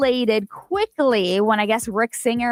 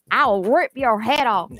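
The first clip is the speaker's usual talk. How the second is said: put-on slow drawn-out country accent; hillbilly accent. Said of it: "hillbilly voice that he does".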